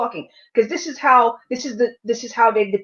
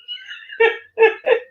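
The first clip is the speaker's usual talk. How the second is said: very high-pitched laugh; disbelieving laugh. Real, not acted.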